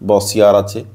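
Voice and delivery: ordinary talk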